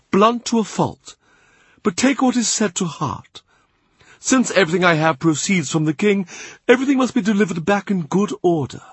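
a prelate smoothing things over with grace; Cardinal's dignified voice. A narrator's reading.

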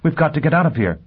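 high-pitched, gasped urgency; gasping. A narrator's reading. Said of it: "she gasped at last".

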